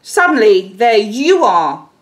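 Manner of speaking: normal talking voice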